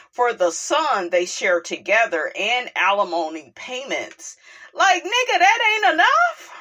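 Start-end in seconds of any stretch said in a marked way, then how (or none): 4.7-6.5 high, loud disbelief; exasperated and fired up; incredulous outburst. Real, not acted. Outburst at ex-husband's demands.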